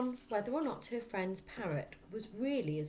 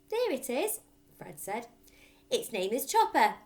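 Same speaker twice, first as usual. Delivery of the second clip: put-on proud little boy; 6 semitones higher, 9 dB louder than usual. A narrator's reading. Fred shows the parrot.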